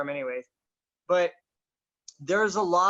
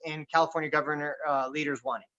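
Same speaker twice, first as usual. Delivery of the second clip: ordinary talk, nothing marked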